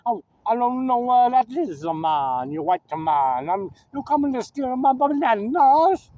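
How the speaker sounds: hammed-up island accent, rising to a high pitch; mock Jamaican accent. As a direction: imitate a suspicious Jamaican islander. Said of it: "they think they're still on the island".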